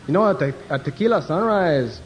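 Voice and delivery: hammed-up Mexican accent. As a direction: play a Mexican passenger asking for a drink. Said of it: "the one Mexican said".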